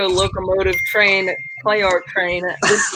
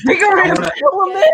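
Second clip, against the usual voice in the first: high, louder incredulous echo; baffled, higher-pitched question; puzzled surprise; 10 semitones higher, 3 dB louder than usual. Real, not acted. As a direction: echo it like you've never heard it. Echoes an unfamiliar phrase.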